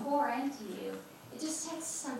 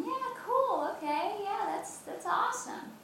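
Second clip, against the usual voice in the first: breezy reader; 10 semitones higher than usual. Retold or imitated. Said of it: "you're going".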